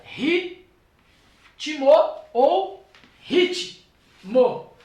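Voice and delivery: Ritmo with a rising tune, then ritmo drawn out very slowly; syllable split demo. Shown as a demonstration. Teacher contrasting two syllable divisions of ritmo, slow. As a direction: show both ways to split ritmo, slow. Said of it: "dividir em sílaba da seguinte maneira".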